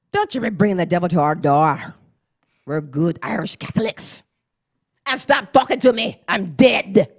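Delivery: stern ghost. Retold or imitated. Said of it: "I hear this voice".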